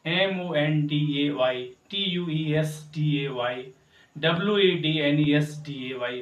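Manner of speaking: steady letter by letter spelling; spelled out letter by letter. Shown as a demonstration. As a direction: spell each day name out. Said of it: "इसे हमको क्या पढ़ते हैं?".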